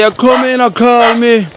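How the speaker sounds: high, slow, loud mock scolding; hammed-up shouted complaint; mock yell. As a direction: shout her words back at her, teasing. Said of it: "She say".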